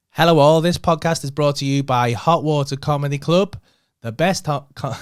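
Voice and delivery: loud upbeat ad read. Shown as a demonstration. Podcast host reads sponsor ad, loud and upbeat. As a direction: sell it like a radio sponsor spot. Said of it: "I'll play it".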